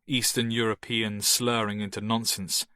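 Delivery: usual speaking voice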